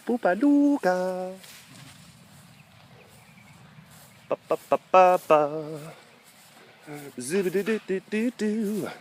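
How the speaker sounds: playful sing-song cooing. Real, not acted. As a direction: babble at the cat. Baby talk to the cat.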